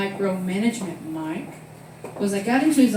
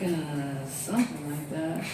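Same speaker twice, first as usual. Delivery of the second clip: sheepish mumble; 5 semitones lower, 9 dB quieter, slower than usual. Retold or imitated. Manager voiced sheepishly.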